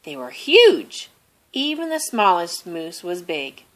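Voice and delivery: ordinary delivery, nothing marked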